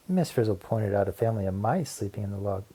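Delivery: ordinary delivery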